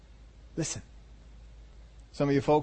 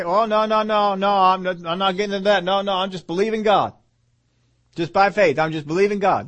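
higher, louder stubborn refusal; mock protest; 5 semitones higher, 4 dB louder than usual. Preacher imitating a believer who won't listen, mock protesting. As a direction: imitate a believer insisting over objections.

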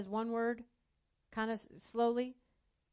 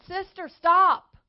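preacher sternly ordering a woman to stop begging; firm command; 7 semitones higher, 10 dB louder than usual. Retold or imitated.

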